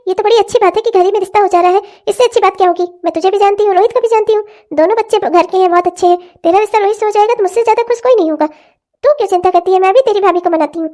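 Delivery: high, fast mother's voice fussing over her daughter; a fretting mother coaxing her crying girl; worried old mother. Acted. (बेटी को पुचकारती चिंतित माँ)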